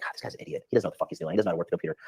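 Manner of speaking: whispered scorn under the breath; inner thought whisper. Retold or imitated. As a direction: hiss it privately about the partner. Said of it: "in my mind, I was like".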